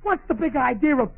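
high, loud, outraged disbelief; indignant outburst. Acted. Husband learns where the pot roast went, indignant. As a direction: protest loudly, voice jumping up.

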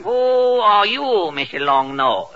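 slow mock Chinese detective question; drawn-out caricatured Chinese accent; One Long Pan. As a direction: interrogate slowly as a hammy stage detective.

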